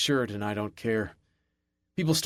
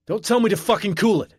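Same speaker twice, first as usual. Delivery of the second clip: much higher and louder roar; 9 semitones higher, 6 dB louder than usual. A narrator's reading. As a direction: roar it back at the guard. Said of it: "I roar".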